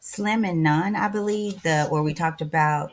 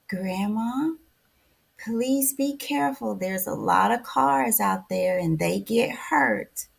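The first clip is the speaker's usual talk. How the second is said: put-on higher, drawn-out sleepy child; sleepy little-girl voice; 5 semitones higher, slower than usual. Grandmother imitates her five-year-old granddaughter on the phone, sleepy child voice. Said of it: "in the most beautiful, sleepy voice".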